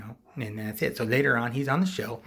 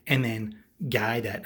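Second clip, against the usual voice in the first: ordinary delivery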